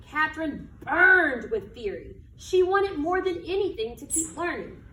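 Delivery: slow, expressive picture-book reading; reading to children. Acted. Teacher reading a picture book to her class, storytelling voice. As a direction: read it like storytime on the rug.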